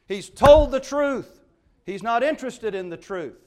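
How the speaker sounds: preaching at full force; emphatic preaching. Real, not acted. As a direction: drive the point home.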